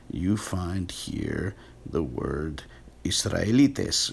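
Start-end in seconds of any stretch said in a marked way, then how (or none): 3.0-4.1 "Israelites" in Greek, ending in -EE-tes; Greek pronunciation. Shown as a demonstration. Lecturer reads the word in the Septuagint, Greek pronunciation. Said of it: "that's where the word Israelite comes from".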